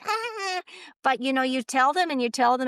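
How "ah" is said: mock shriek of alarm. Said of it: "And they're like".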